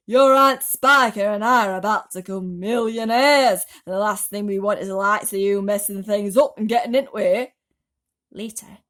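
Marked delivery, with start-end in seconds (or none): 0.1-7.5 loud, harsh scolding aunt; angry scolding. Acted. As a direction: lay into the boy.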